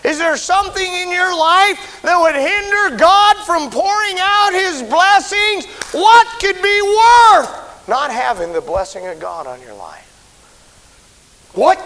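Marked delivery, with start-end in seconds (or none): 0.0-10.1 high, loud, slow shouted preaching; full-force preaching. Real, not acted. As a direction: thunder it out from the pulpit.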